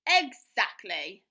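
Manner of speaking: loud, very slow, deliberate; flatly confirming; firm woodcutter. Acted. Woodcutter's retort.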